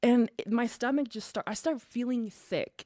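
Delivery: ordinary talk, nothing marked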